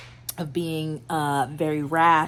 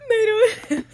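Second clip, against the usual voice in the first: high squealing laughter breaking into words; giddy laugh; 4 dB louder than usual. Real, not acted. Reader squealing over the romance she's reading. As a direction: squeal with delight at the page.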